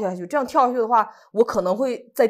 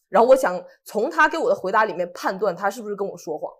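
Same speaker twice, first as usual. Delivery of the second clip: normal talking voice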